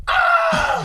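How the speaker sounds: sharp gasp then a high loud scream; imitated terrified scream. Telling a friend how passengers screamed, high gasping scream. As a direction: gasp and shriek like screaming for your life.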